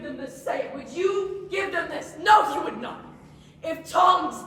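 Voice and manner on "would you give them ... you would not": loud and higher, answering himself; shouted challenge. Acted. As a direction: hurl the question at her. Repeated question rising to shout.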